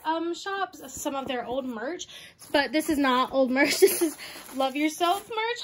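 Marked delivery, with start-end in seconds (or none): none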